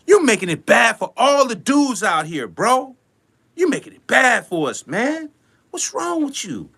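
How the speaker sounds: ordinary talk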